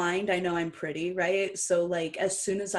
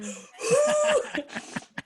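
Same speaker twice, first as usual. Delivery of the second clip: gasp; 5 dB louder than usual. Retold or imitated. Group's stunned reaction.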